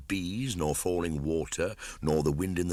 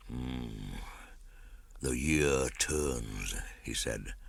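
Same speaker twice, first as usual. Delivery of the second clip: slow low panther voice; 4 semitones lower, 5 dB quieter, slower than usual. A narrator's reading. Bagheera speaks slowly.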